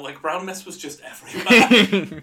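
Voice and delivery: laughing through the words. Real, not acted. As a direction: chuckle it out, slowed. Laughing at own joke.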